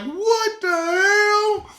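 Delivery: high, slow, disbelieving cry of surprise; shocked. Retold or imitated. Scrolling past cheap designer bags, a slow stunned cry.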